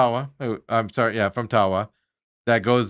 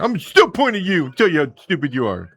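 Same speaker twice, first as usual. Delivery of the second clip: mock tough guy. Mocking the manager's tough-guy act.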